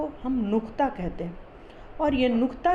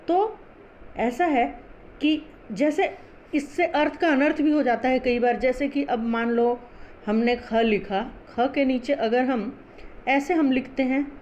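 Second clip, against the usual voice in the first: normal talking voice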